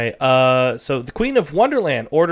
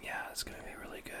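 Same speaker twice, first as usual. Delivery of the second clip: soft playful ASMR whisper; giggly prank whisper; 19 dB quieter, much faster than usual. Pretend. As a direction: whisper close to the mic, giggling. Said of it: "let's whisper into the mic".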